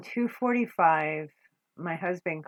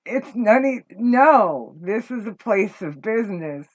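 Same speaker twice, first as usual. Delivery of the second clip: higher and louder, telling him off; indignant rant; 4 semitones higher, 7 dB louder than usual. Real, not acted. Venting at the man who hit on her at work, heated and loud. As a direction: let the outrage boil over.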